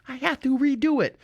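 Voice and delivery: high, exaggerated mouthed imitation; wife mouthing words. Wife tells husband across the room, exaggerated mouthing. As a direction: mimic mouthing each word at a distance. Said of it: "you know, with her mouth".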